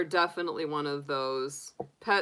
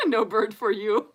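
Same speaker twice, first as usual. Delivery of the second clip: put-on Russian accent; 4 semitones higher, 5 dB louder than usual. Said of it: "he had the accent".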